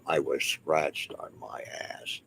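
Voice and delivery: whispered, slow, mocking a trivial claim; slow mock whisper, no voice behind it. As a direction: breathe it out like a silly ghost-hunter tale. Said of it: "Not some of this".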